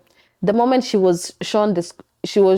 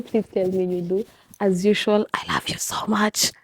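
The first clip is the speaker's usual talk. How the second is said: whisper; 5 dB quieter than usual. Real, not acted. Whispered goodbye to viewers.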